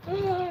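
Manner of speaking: mock high yelp of the woman; imitated yelp. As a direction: squeal it like the startled wife. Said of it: "she starts yelling".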